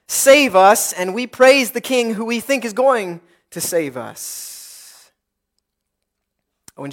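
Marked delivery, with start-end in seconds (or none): 0.1-0.9 raised, louder plea for rescue; crying out. Acted. Preacher voices the people hailing their king, crying out. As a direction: call it out as a crowd's cry for help. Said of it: "people are crying out in the same breath".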